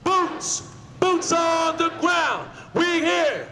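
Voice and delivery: high shouted protest speech; rousing union rally. Real, not acted. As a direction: shout it out to the whole street. Shouting to diners from march.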